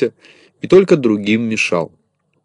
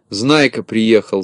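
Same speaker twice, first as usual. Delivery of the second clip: plain reading voice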